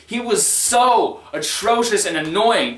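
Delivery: ordinary talk, nothing marked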